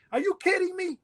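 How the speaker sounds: high-pitched mock incredulity, imitating the son; son's disbelief. Son mocking his dad for sleeping, high incredulous imitation. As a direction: toss it back at him like he can't believe you slept through it. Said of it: "He goes".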